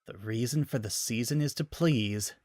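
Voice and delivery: soft bitter hiss. Acted. Samus hissing at the scientist without turning. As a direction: hiss it through contempt. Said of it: "she hissed".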